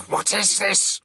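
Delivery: high, strained gasp of a choking senator; gasped question. A narrator's reading. Senator pinned to the wall gasps in alarm. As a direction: squeeze it out while being throttled. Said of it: "the man managed to gasp".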